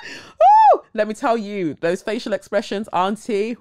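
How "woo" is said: high, loud laughing cheer; laughing whoop. Real, not acted. Cheering Auntie Viola's performance, laughing. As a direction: whoop with delight.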